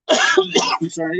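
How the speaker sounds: cough-roughened voice; clearing a frog from the throat. Real, not acted. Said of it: "I got a frog in my throat".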